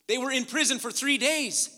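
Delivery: loud, high, impassioned sermon; preaching at full force. Real, not acted. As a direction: hammer the point home to the congregation.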